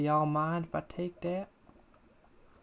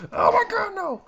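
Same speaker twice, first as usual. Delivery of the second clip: much higher, louder panicked cry; panicked scream; 10 dB louder than usual. Real, not acted. Player panics at a threat, high scream.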